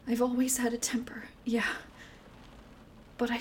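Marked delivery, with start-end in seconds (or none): 0.1-1.3 soft unvoiced whisper; remorseful whisper. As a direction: confess it under your breath. Quiet remorse after yelling.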